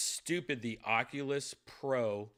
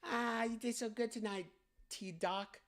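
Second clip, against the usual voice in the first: mock fangirl voice, higher and a bit softer; gushing K-pop fan; 11 semitones higher, 4 dB quieter than usual. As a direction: coo at him like a starry-eyed fan. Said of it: "like I'm a K-pop fan".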